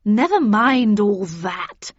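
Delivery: loud, drawn-out brush-off; imperious old lady with no patience; impatient exclamation. A narrator's reading. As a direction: cut him off loudly and impatiently. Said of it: "exclaimed Miss Havisham, to whom patience was an alien concept".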